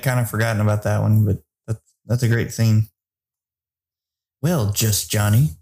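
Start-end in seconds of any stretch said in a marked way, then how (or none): 4.4-5.5 drawled quote. Retold or imitated. Quoting the running gag.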